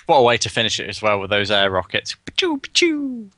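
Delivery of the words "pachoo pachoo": very high, drawn-out rocket sound effect; imitated rocket fire. Caster replays those air rockets, high imitated firing noise. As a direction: mimic rockets shooting off, high and falling.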